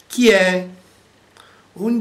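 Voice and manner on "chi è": high, louder parrot call. A narrator's reading. Parrot calling at the door, high call. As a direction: squawk it like a parrot. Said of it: "Questo uccello sapeva dire solo una cosa".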